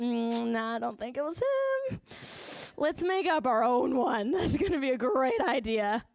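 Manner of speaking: hammed-up doubtful hum into silly enthusiasm; mock dismissive. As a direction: play the fool pitching a terrible idea. Mocking the Israelites' foolish reasoning.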